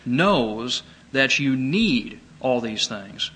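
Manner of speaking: knows that you need all these things, slowed right down with "need" leaned on; slow stress on need. Shown as a demonstration. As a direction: draw it out and press the word need. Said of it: "the way that Jesus says this".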